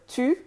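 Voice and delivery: 입술을 둥글게 모아 앞쪽에서 내는 tu; 'tu'를 프랑스어 u 소리로 또렷하게; French u. Shown as a demonstration. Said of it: "the U in the word".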